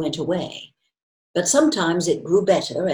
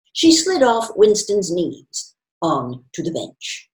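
usual speaking voice

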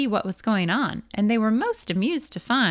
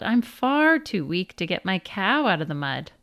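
usual speaking voice

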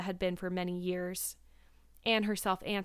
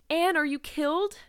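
high, loud cry of alarm; shrieking; 7 semitones higher, 6 dB louder than usual. A narrator's reading. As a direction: shriek it in alarm, moderately hammed-up. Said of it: "shrieked Diana".

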